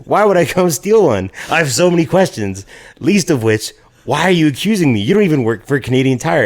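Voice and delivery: high, heated rant of disbelief; indignant protest. Retold or imitated. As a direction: fire off offended questions, pitch riding high. Acted indignant quarrel over accusation.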